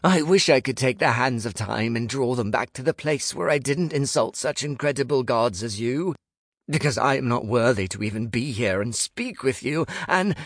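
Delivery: high, breathless-sounding grovelling run; frantic rambling. A narrator's reading. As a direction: ramble on desperately without a pause. Frantic rambling apology to gods.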